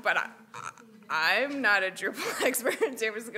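acting out her own objection to the boss; retold flustered protest. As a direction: stammer into a playful protest.

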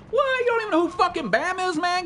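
very high incredulous what you don't know; mock-outraged, how could you not know; outraged disbelief. Real, not acted. Streamer stunned a viewer doesn't know Bam, high incredulous.

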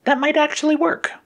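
very high, hesitant realization; high-pitched sidekick coming round; Yellow's character voice. A narrator's reading. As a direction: speak high, pausing after the first word. Yellow warms to the plan.

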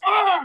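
loud, high pained "aah"; groan of pain. Acted. Bar owner with a bumped head, loud pained cry.

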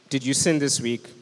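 ordinary delivery

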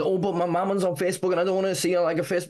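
high, rushed mock-objection; mocking whiny excuse. Activist mimicking followers afraid to like pages publicly, mocking high voice.

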